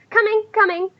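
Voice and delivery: high, hurried-sounding singled-out call. Acted. Woman answering through the door, calling out.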